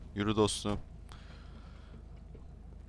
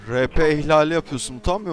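scolding loudly; 4 semitones higher, 8 dB louder than usual. Acted. Scolding player for rule break.

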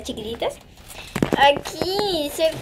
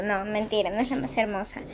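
quiet aside; 4 semitones lower, 8 dB quieter than usual. Real, not acted. Takes back joke.

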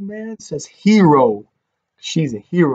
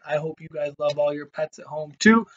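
ordinary delivery

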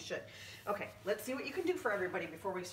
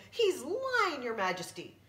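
high, loud tattling pirate; pirate accuser; 8 semitones higher, 5 dB louder than usual. Acted. Pirate tells the queen he lies, high loud accusing voice. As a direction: shout out the accusation.

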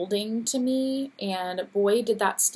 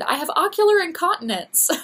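quoting Cindy; 9 semitones higher, 7 dB louder than usual. Retold or imitated. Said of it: "Cindy kept saying all weekend".

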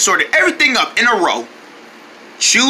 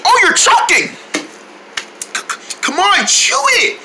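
high frantic urging; panicked; 10 semitones higher than usual. Acted. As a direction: panic as he chokes. Panic as friend chokes.

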